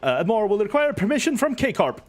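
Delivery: high, booming, grandly cheerful hero; jovial knight voice. Acted. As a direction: proclaim it like a beaming showman.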